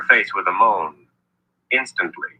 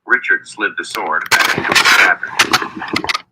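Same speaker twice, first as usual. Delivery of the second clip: plain reading voice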